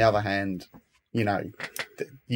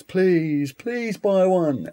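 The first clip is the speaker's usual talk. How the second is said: high, drawn-out beggar's plea; pleading shout; 9 semitones higher, much slower than usual. Retold or imitated. Street seller pleading with passers-by, slow high shout. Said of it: "who shouts".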